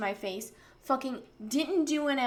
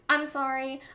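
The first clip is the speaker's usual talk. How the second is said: usual speaking voice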